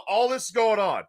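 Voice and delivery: usual speaking voice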